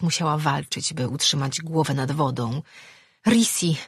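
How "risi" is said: high, frightened shout of his name; frightened call. A narrator's reading. Girl calling her friend in rough sea, frightened shout. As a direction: call out for him in the waves, scared. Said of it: "zawołała wystraszona".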